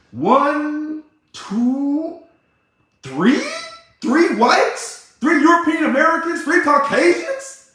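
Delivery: mock disbelief. Real, not acted. Mock counting, incredulous.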